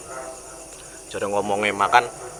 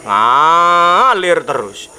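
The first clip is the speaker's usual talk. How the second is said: stretched out; 4 semitones higher, 6 dB louder, much slower than usual. Acted. Stretching the flowing sin.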